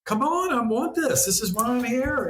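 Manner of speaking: an excited soul cheering you on; eager soul. Retold or imitated. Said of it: "And it was like".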